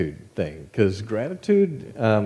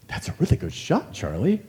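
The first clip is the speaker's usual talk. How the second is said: imitating his friend; 5 semitones higher than usual. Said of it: "he'd go".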